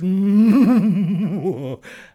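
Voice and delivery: loud high villain cackle; booming evil laugh; evil genie laugh. Acted. Genie laughs after threat.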